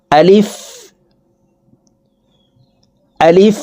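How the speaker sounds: আলিফ said short and quick, no stretch, rising at the end; quick short alif. Shown as a demonstration. Teacher demonstrating the letter for students, quick and unstretched. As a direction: clip আলিফ short, no drawn-out vowel. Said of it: "এই আলিফ উচ্চারণ করার সময় দ্রুতভাবে উচ্চারণ করতে হবে".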